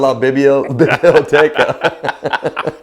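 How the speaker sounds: very slow, laughing bad-accent Spanish; laughing through a hammed-up Kentucky accent; mock Kentucky Spanish. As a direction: stumble through it like a tourist. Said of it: "Kentucky dialect of, of French".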